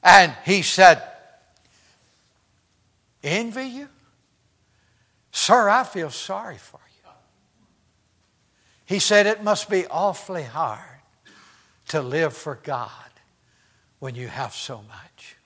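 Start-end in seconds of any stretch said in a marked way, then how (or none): 3.2-7.1 very soft, near-whispered answer; reply gently, almost under the breath; hushed retold reply. Voices poor pastor's humble reply. 9.2-15.4 low, very soft, trailing into whisper; hushed retold reply. Voices poor pastor's humble reply.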